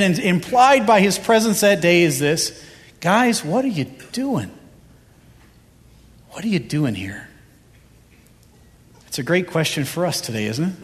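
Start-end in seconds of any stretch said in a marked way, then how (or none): 3.0-4.5 gentle voice of the Lord. Acted. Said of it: "So he says to them". 6.3-7.3 hushed repeat. Acted. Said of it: "So he says to them".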